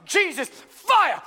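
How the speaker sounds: rising to a loud mock shout of fire; preacher's cry. Preacher at the altar call shouting at the crowd, hammed-up. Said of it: "the preacher would be like".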